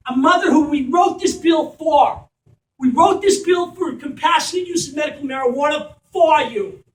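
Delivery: loud, raw outcry with the word wrote hit hard; passionate shout. Real, not acted. Mother rallying the school community for her child, impassioned shout.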